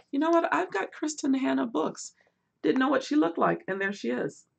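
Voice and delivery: suddenly delighted discovery; excited surprise. Real, not acted. Vlogger spots the author's photo, excited and high. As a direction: voice jumps up, thrilled.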